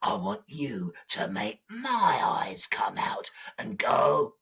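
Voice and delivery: low, breathy predator voice; deep big-cat menace; demanding jaguar. Acted. Jaguar makes his demand.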